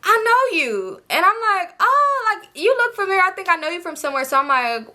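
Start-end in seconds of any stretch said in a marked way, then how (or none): 0.0-1.0 high, mimicked realization; high-pitched imitation of recognizing someone; surprised recognition. Voicing son recognizing her. 1.8-4.2 high, quickening realization; high-pitched retold surprise; feigned recognition. Her acted reaction to being recognized.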